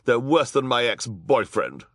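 high, loud, irritated voice; snapping. A narrator's reading. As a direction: snap it as a scornful villainess. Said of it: "Esme snapped".

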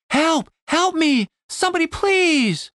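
high-pitched, acted cries for help; shrieking for help. A narrator's reading. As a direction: shriek like a victim in distress. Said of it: "then started shrieking".

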